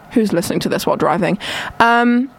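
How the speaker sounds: ordinary talk, nothing marked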